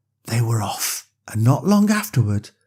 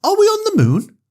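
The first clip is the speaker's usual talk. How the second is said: put-on high, wondering child's question; little girl; 4 dB louder, faster than usual. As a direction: ask in awe like a small girl. Lexi's voice in story.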